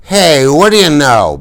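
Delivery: put-on sway through it like a drunk bully; hammed-up drunken sneer; drunk Soc sneer. A narrator's reading. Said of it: "Bob said a little unsteadily".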